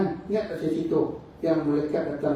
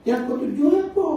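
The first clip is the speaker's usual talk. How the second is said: much higher, louder pleading question; urgent demand; 13 semitones higher, 8 dB louder than usual. Acted. Prophet begging the angel to name the seventh, high urgent plea. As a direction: plead to know the last layer.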